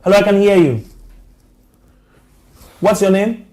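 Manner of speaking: loud, high call down a bad phone line; to someone hard of hearing. Real, not acted. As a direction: raise your voice so the caller hears. Caller barely audible on phone line.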